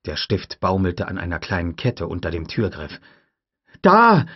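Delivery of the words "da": sharp shout. A narrator's reading. Tim pointing urgently.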